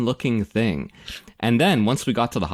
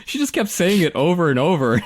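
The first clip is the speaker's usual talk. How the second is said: ordinary delivery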